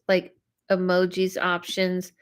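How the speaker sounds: usual speaking voice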